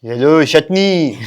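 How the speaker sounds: slow, hammed-up French accent. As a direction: lilt it like a Frenchman. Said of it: "Let's start with French?".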